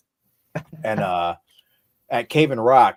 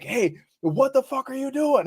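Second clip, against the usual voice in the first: a much higher voice calling out to him; friend calling out. Retold or imitated. A friend calling to him in the bushes, higher voice.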